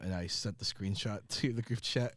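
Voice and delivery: ordinary talk, nothing marked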